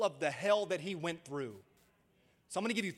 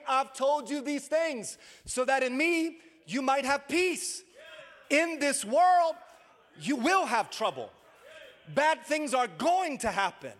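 loud, high, driving preacher's voice; preaching at full force; 7 semitones higher, 8 dB louder than usual. Acted. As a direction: rouse the congregation with rising fervor.

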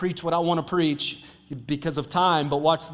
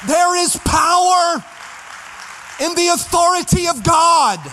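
preaching shout; 10 semitones higher, 4 dB louder than usual. Real, not acted. Pastor shouting to the congregation, full-force preaching cry. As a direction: belt it out to the whole church. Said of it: "I feel like preaching today".

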